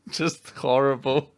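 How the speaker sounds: laughing through the words; giggling while talking. Real, not acted. Laughing at the awful planet.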